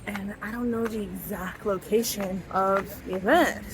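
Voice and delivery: ordinary delivery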